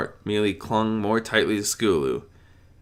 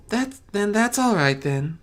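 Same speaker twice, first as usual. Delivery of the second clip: gentle hesitant allowing; Fluttershy voice; 14 semitones higher than usual. A narrator's reading.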